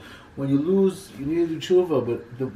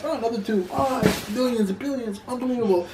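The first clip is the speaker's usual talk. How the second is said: higher-pitched wonder at a find; mock amazement; 7 semitones higher than usual. Storyteller voicing a man finding gems in his pocket, amazed.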